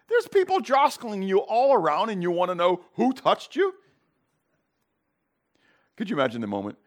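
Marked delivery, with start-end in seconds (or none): 0.1-3.7 scoffing, much higher put-on voice; a pfft and a high sneer at his question; mocking disbelief. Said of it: "kinda mock him".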